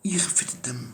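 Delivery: whispered "إيه خفة الدم؟" with no voice, teasing; mocking whisper. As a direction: breathe the jab as a sly whisper. Mocking the player's humour.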